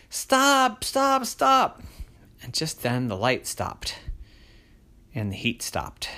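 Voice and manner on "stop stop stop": put-on high, louder pleading girl's voice. Crystal begs the burning light to stop, high and loud. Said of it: "And she said".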